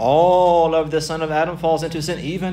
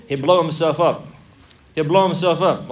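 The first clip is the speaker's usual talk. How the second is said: usual speaking voice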